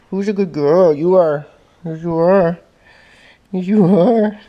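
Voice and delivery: sing-song pet praise. Real, not acted.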